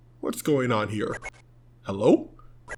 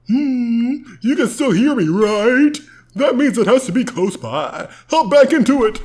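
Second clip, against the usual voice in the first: high sidekick voice; 9 semitones higher than usual. Acted.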